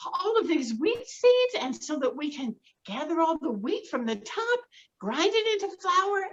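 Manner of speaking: high, loud, thrilled hen voice; high excited hen calling her chicks; excited mother hen. Acted. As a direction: gush like a delighted mother hen. Hen calls friends.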